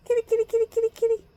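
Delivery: high sing-song call to a kitty; calling the cat. Real, not acted. Calling her cat to come.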